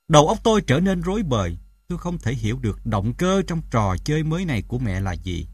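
plain reading voice

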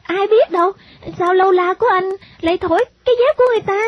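put-on high, quick child's voice; little girl retorting. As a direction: answer back fast in a high girlish pitch. Girl answers and scolds boy.

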